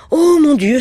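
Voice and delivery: high, loud exclamation; shocked cry. Acted. Wife crying out to the sergeant, feigning sudden shock. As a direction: gasp it out as if just remembering. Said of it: "s'écria-t-elle".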